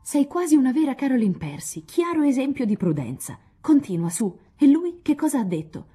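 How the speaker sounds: high, loud, gleeful teasing; clapping her hands with glee; laughing delight. A narrator's reading. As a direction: sister cheering Meg on, delighted. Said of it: "con una risata".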